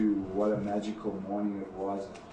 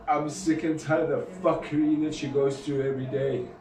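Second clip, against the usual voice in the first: louder, higher, furious confession; angry outburst; 6 semitones higher, 6 dB louder than usual. Real, not acted. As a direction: spit out the frustration. Confessing anger at his failure.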